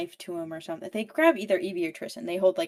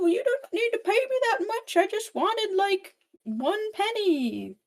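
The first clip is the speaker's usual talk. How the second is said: put-on much higher, frail old-lady voice; sweet old lady; 13 semitones higher than usual. Old painter refusing the villain's gold, high granny voice. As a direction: go way up like a humble granny.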